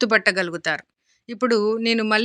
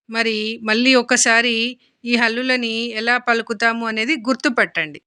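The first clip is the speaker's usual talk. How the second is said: ordinary talk, nothing marked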